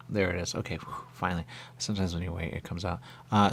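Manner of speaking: usual speaking voice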